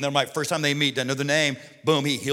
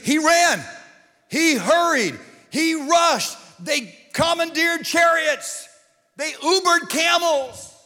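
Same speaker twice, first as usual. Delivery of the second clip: higher and louder, rising toward a comic peak; building excitement; 10 semitones higher, 4 dB louder, slower than usual. Acted. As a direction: pile up the urgency, each line higher. Preacher building on Jesus' rush.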